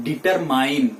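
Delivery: "determine" ending like MINE; common mispronunciation. Shown as a demonstration. Teacher quoting the habit, determine said dee-ter-MINE. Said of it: "determine is wrong".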